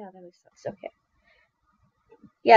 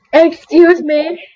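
mock offended; 4 semitones higher, 4 dB louder, slower than usual.

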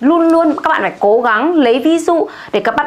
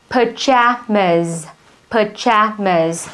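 slow pajamas, JA stressed; stress on middle syllable; 3 semitones lower, much slower than usual. Shown as a demonstration. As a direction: stretch pajamas, hit the JA. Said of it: "trọng âm nó sẽ là pajamas". (nhấn trọng âm giữa từ pajamas)

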